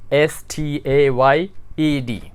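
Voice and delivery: "S T A Y E D" spelled letter by letter, very slowly; slow spelling. Shown as a demonstration. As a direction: spell the new form out slowly for students. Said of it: "E D का इजाफा लगेगा". (अक्षर-अक्षर बहुत धीरे)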